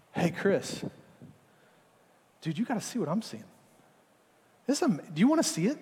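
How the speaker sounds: friendly encouraging call to a friend; inviting, gentle. Acted. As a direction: lure him to come see the view. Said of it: "There's another option".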